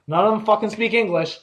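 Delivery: ordinary talk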